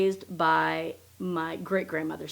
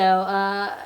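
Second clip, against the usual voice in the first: ordinary delivery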